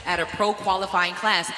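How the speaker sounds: loud, high stage emcee call; booming pumped announcer. Acted. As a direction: pump up the crowd and athletes. Emcee hyping bodybuilding stage.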